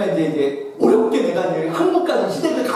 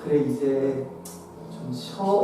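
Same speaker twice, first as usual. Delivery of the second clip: much lower and softer, gentle; empathetic reply; 9 semitones lower, 10 dB quieter than usual. Retold or imitated. Wife comforting her exhausted husband, soft and gentle. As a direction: comfort him tenderly.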